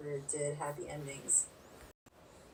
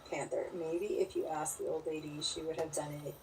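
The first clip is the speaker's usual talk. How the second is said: usual speaking voice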